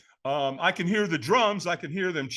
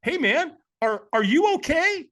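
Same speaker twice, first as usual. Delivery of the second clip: high, drawn-out question of concern; imitating a puzzled onlooker; 11 semitones higher, 4 dB louder than usual. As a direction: ask slowly, pitched up, as if something is wrong. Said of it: "looks at me kind of weird".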